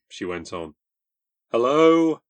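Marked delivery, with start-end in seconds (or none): 1.5-2.2 loud, high hello into the receiver; hello, much higher and louder. Acted. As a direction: call out over the static. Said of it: "I said loudly".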